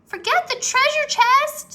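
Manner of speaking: high, loud pleading yell; urgent shout. A narrator's reading. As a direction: yell at him to leave. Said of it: "said Annie".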